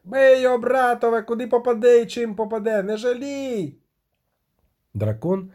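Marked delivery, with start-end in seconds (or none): 0.0-3.8 high, sustained rallying shout; battle cry. A narrator's reading. Militiaman rousing his brothers to beat the dragon, high shouting. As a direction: urge the men on in a high, strained yell.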